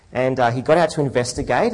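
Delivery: usual speaking voice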